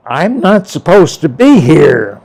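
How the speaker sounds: emphatic retold objection; retold protest. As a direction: protest it firmly, leaning on BE. Retold protest to the spirit gentleman.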